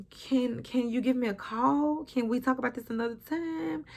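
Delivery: soft, hushed asking to talk later; quiet plea at the door. Retold or imitated. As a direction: keep it down so the man inside won't hear. Sabrina hushing Calvin at door.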